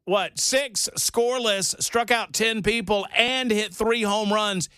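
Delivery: high, slow, emphatic amazement; incredulous raving. Real, not acted. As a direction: marvel at each feat, pitched high and drawn out. Amazed rant about a player's feat.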